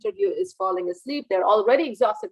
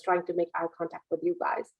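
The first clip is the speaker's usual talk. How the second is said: normal talking voice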